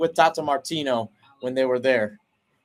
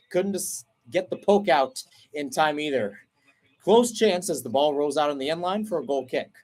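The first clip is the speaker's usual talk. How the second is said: ordinary delivery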